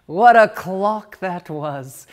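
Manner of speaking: ordinary delivery, nothing marked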